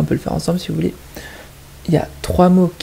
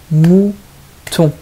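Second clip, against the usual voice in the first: "mouton" in two separate, stretched syllables; split into syllables; 5 dB louder than usual. Shown as a demonstration. Teacher showing children the two syllables, "mouton" split apart. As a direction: break "mouton" into two loud beats. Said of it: "Deux syllabes".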